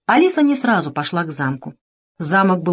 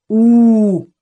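bear's hum; 2 semitones higher, 6 dB louder than usual. A narrator's reading. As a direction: drone it out like a bear. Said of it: "сказал медведь".

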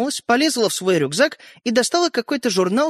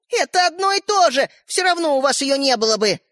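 high, insistent retort; arguing back; 8 semitones higher than usual. A narrator's reading. Sister argues with brothers over toy, indignant. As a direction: dismiss it in a high voice.

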